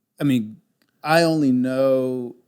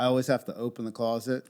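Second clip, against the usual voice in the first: ordinary talk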